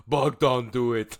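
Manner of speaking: put-on heavy accented big man voice; booming giant voice; Bogdan impression. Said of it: "Bogdan comes in and he goes".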